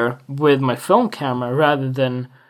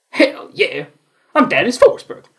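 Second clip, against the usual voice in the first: higher, louder hype cry; mock-triumphant self-announcement; triumphant shout; 4 semitones higher, 5 dB louder than usual. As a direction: hype yourself up like a wrestler.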